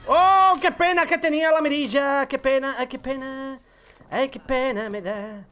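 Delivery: fake-mourn the enemy he just killed; mock lament. Mocking lament after killing enemy.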